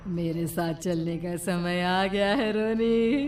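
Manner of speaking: soft, slow witch menace; sinister witch. Acted. Witch Rohna claiming Roni, soft and slow menace. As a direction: lure him slowly and darkly.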